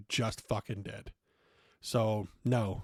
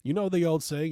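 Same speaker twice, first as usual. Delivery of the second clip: indignant retort; 6 semitones higher, 4 dB louder than usual. Retold or imitated. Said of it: "I'm going".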